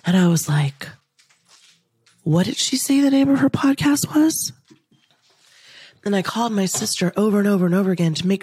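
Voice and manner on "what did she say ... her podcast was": much higher pitch, wondering aloud; retold puzzled question. Videographer asks about the podcast's name, high puzzled voice. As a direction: lift it high, imitating someone trying to recall.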